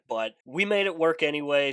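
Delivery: ordinary delivery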